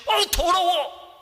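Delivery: slow, high disgusted groan; drawn-out hammed-up disgust; mock disgust. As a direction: sneer at something filthy. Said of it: "귀신은".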